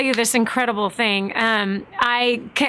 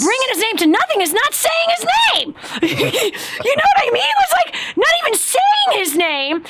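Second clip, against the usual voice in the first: much higher pitch, breaking into a laugh; excited; 11 semitones higher than usual. Real, not acted. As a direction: voice jumping high with excitement. Excited point about God's name.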